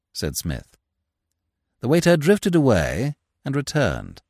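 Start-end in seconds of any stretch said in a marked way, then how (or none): none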